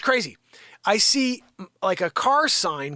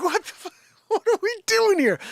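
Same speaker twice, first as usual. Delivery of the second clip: much higher, exasperated disbelief; incredulous outburst; 14 semitones higher than usual. Retold or imitated. Driver told to rush the car to Ford, incredulous outburst. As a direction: throw up your hands in disbelief.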